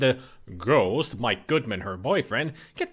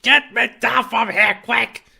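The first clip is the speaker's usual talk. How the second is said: high, loud cry for help; panicked shout; 9 semitones higher, 6 dB louder than usual. Acted. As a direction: yell it in panic, burning. Ghost on fire pleads.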